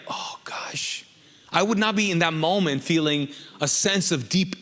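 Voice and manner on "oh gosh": mock whisper. Mock tearful reaction at funeral.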